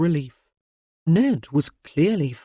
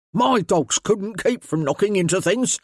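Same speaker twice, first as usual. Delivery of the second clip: belligerent; 3 semitones higher, 3 dB louder than usual. A narrator's reading.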